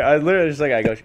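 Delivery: ordinary delivery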